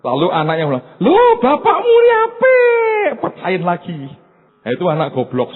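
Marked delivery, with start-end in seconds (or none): 1.0-3.1 put-on very high, slow, wailing child voice; high whiny child voice, slow and loud; silly child. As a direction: squeal it like a foolish proud kid. Naive child voice.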